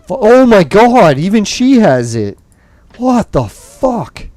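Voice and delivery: high, loud pissed-off disbelief; exasperated outburst. Real, not acted. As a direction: cry out in real exasperation at the game. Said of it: "I haven't been so pissed at the game".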